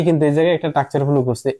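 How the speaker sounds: same line rattled off quickly; fast repeat. Shown as a demonstration. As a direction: hurry through the restatement.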